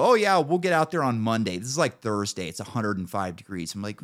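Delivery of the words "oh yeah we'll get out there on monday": mock dispatcher. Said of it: "they go".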